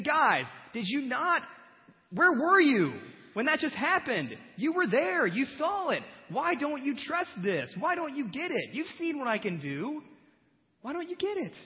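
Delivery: high, loud exasperated pleading; exasperated Jesus. Retold or imitated. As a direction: plead with clueless friends. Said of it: "He's thinking".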